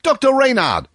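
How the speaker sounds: high loud hail across the road; loud call to Doctor Reynard across the road. A narrator's reading. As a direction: shout to a friend at a distance. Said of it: "calling out".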